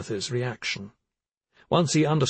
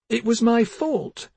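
tearful confession; 12 semitones higher, 3 dB louder, slower than usual. Acted. Girl sobs confession.

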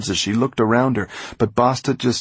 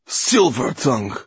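loud, venomous contempt; spat like poison; 6 semitones higher, 5 dB louder than usual. A narrator's reading. Mortola addressing hated Mo, spitting the name like poison. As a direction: spit the name out in disgust. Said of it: "spat out the word as if it were poison".

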